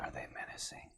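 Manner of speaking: hushed whispered question; whispering. Real, not acted. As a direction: whisper it conspiratorially.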